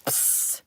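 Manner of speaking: short voiceless "psst" of a flame; imitated torch hiss. Streamer firing a gifted torch, short hissed sound effect. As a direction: hiss like a little blowtorch.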